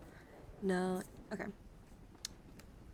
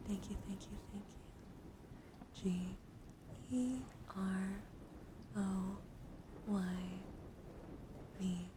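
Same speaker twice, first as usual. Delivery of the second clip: hushed, soothing thanks, partly whispered; ASMR soft speaking; 6 dB quieter than usual. Real, not acted. Streamer welcoming a subscriber to get cozy, soft ASMR. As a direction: lull the listener gently.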